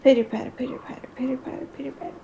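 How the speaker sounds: rapid whisper, fading away; whispered pitter-patter. Pretend. Player imitating mouse footsteps, quick whisper.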